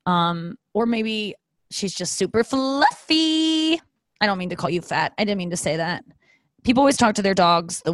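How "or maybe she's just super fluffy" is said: slow, high, fond tone; sweet dog praise. Real, not acted. Owner sweetly reassuring her dog she's just fluffy. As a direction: say it tenderly to the dog.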